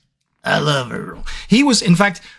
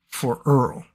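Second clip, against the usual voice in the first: Tommy Lee Jones voice; 3 semitones lower, 5 dB quieter than usual. Shown as a demonstration. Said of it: "didn't want to do straight up Tommy Lee Jones".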